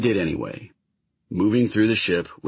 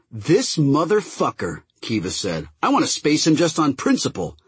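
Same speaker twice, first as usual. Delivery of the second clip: angry; 7 semitones higher than usual. Acted. Kiva furious at the prisoner.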